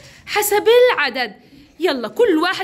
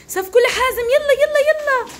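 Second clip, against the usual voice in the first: high, urgent call for applause; excited teacher cheering a pupil on; rallying cheer; 6 semitones higher than usual. Real, not acted. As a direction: whip the class into clapping. Rallying class to clap.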